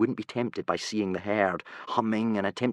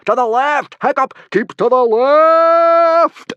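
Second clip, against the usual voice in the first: much higher, louder drawn-out roar; 12 dB louder, slower than usual. Acted. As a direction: bellow after the bolting rider. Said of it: "roared Gobber the Belch".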